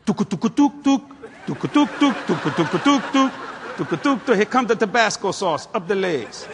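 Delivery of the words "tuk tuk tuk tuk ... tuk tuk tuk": pulse thumping. Retold or imitated. Imitating a pounding pulse.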